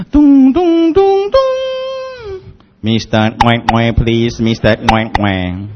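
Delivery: 高声唱出"咚咚咚咚"，慢慢广播"Mister Ngwe Ngwe"; 像广播员叫他到柜台; mock store announcement. (模仿英文广播)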